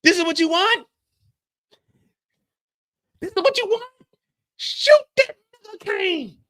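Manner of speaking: high, loud mock yelling; hammed-up high yell across the room. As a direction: bark it out like a shootout call. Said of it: "[name]'s yelling".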